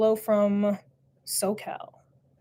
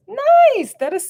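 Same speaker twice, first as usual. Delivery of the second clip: excited; 14 semitones higher, 10 dB louder, slower than usual. Real, not acted. Reacts to parrot news.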